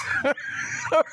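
high-pitched burst of laughter; real laugh. Real, not acted. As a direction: crack up at your own story. Laughing at his own story.

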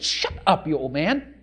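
harsh whispered hush, then low and soft; hissed shut up. Acted. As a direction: shush the old man down. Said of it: "the people said".

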